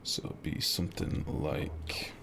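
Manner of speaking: quiet whisper to himself while building; hushed working whisper; whispered muttering. Real, not acted. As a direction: mutter it under the breath, slow.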